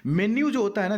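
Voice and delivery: normal talking voice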